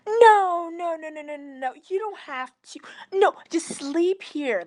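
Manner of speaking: high, loud burst of no, then pleading; insistent pleading. Retold or imitated. Host begging the narrator to sleep over, high insistent pleading. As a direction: beg them not to leave.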